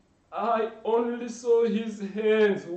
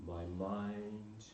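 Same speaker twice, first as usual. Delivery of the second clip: much lower, softer, slow; stunned hush; 18 dB quieter, slower than usual. Acted. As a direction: let the words go empty. Stunned after seeing drowning.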